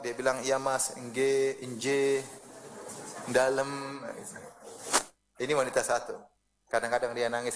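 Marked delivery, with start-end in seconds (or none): 0.4-4.0 low, drawn-out submissive replies; patient, obedient wife. Acted. Obedient wife answers her scolding husband, patient and submissive. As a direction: answer slowly and meekly, each word stretched. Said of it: "dia sabar".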